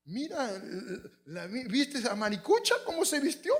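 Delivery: high, put-on gossip voice; mock gossiping woman. Women mocking Maricucha's outfit, high gossipy voice. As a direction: gush over what Maricucha wore. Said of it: "las mujeres son tremendas".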